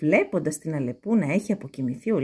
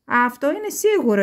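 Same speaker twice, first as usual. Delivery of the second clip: put-on little girl; 6 semitones higher, 6 dB louder than usual. A narrator's reading. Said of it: "είπε η μικρή".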